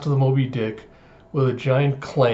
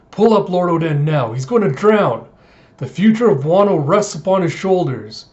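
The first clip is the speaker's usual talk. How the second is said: louder, higher frantic appeal; urgent demand; 4 semitones higher, 5 dB louder than usual. Retold or imitated. Retainer begging the captain to save his lord, urgent. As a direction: cry out as if a life depends on it. Tied-up Izo fears Oden drowning.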